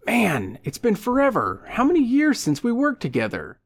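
high, excited greeting; over-friendly old pal Leo. Acted. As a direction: greet a long-lost buddy with eager surprise.

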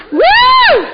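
loud and high, a joyful whoop; playful whoop. Real, not acted. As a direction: shout it out, teasing the crowd. Cheering herself to the audience.

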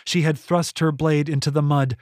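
ordinary delivery

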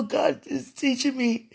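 ordinary talk

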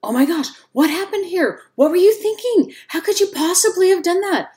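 high, loud, frantic alarm at the scene; panicked responder. Retold or imitated. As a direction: shriek it like a rattled rescuer losing his cool. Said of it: "can't imagine a fireman ... saying".

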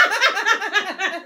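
loud high burst of laughter; big laugh. Real, not acted. Friend cracking up at her own kid story, real laughter. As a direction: let the laugh take over completely.

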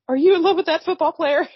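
high, loud, laughing through it; can't keep a straight face asking; laughing question. Retold or imitated. Retelling Peter's question to Vicky, laughing. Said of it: "Peter goes over and asks".

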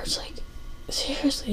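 breathy whisper; whispered aside. Real, not acted. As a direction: drop to a whisper for one word.

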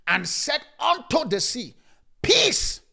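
loud, high, slow command to the sea; commanding rebuke. Acted. Christ rebuking the wind and sea, loud command. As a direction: bellow it at the storm.

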